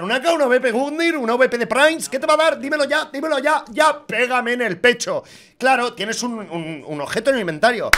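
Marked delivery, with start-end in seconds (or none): 0.0-5.2 high, loud, eager begging for the drop; pleading with the case to give a knife; excited hype. Real, not acted. Streamer begging the case for a prize, high and excited.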